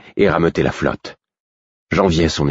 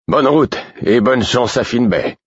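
cheery farewell; 7 semitones higher than usual. A narrator's reading. Friendly captain sends them off.

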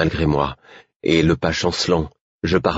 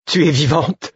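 high, amazed exclamation; joyful cry; 11 semitones higher, 3 dB louder than usual. A narrator's reading. Man finding his lost friend alive, crying out. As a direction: cry out with relief, voice soaring. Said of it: "m'écriai-je".

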